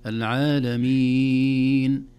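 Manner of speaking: loud العالمين with a very long held ee; six-count madd. Shown as a demonstration. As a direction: chant العالمين, holding the last vowel as long as possible. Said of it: "اثنين وأربع وست حركات".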